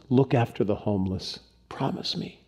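low hush fading to a whisper; deathbed plea. Retold or imitated. Dying father charging his son, hushed deathbed plea. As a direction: softly voice a dying father's last request. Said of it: "said to his son as he lay on his deathbed".

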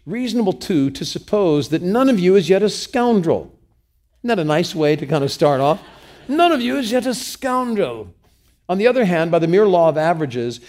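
formal reading. Acted. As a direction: declaim the quoted lecture with weight. Reads a quoted speech aloud.

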